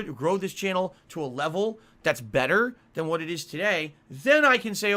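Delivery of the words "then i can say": high and loud burst; rising outburst. Real, not acted. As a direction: push the voice up hard. Heated rant peak.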